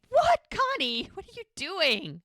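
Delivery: high, loud mock-outraged cry; shocked outburst. Muriel finds out Connie set up her date, shocked outburst. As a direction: act out appalled disbelief, voice shooting up.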